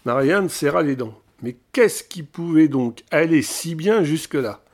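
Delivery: ordinary delivery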